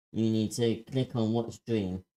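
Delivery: ordinary delivery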